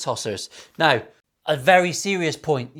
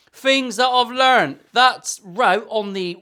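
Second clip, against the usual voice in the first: loud, slow, raised voice; loud drawn-out announcement; shouting; 7 semitones higher, 5 dB louder, slower than usual. Real, not acted. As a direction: slowly call it out loud. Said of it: "why am I shouting?".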